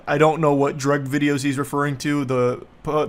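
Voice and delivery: ordinary delivery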